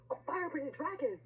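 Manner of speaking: high-pitched mock alarm; storybook cry of alarm; witch crying out. A narrator's reading. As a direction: exclaim it high as the witch in fright. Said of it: "cried the witch".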